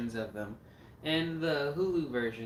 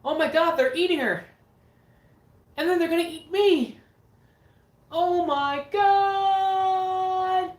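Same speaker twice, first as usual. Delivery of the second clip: high, loud panicked shriek, last Oh my God stretched out; hammed-up horror-movie scream; screaming movie victim; 11 dB louder, slower than usual. Quoting the Troll 2 victim for a viewer, shrieking in mock terror. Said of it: "the guy's like".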